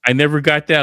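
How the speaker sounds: usual speaking voice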